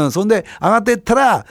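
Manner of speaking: usual speaking voice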